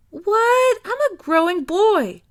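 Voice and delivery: hammed-up boyish outrage, high and loud; mock protest. A narrator's reading. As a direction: protest like a wronged kid.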